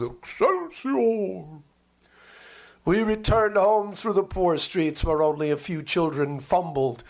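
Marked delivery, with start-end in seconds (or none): 0.0-1.6 slow, high, long-drawn baying call; bay it out like a great mountain dog. Acted. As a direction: howl the word like a hound.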